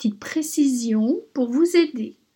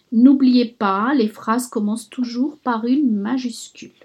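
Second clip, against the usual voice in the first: ordinary talk, nothing marked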